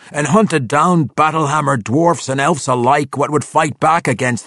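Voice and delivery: high, loud gruff accusation; suspicious old dwarf. Acted. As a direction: veteran dwarf accusing the drow of scheming. Old veteran accuses Drizzt.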